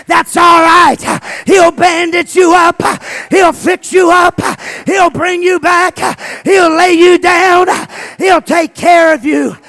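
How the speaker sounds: high, loud shouted preaching; fiery revival preaching; preaching at full force. Acted. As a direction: whoop it up from the pulpit.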